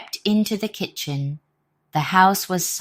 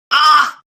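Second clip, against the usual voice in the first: much higher, louder scream; 11 semitones higher, 11 dB louder than usual. Acted. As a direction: shriek in terror. Robbers flee screaming.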